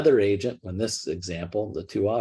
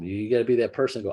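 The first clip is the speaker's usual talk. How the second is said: ordinary delivery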